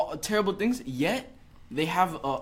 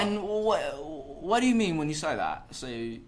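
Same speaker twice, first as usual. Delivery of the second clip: posh-sounding British accent put on; 2 semitones higher, 3 dB louder than usual. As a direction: play at sounding British and clever. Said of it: "that sounds so smooth".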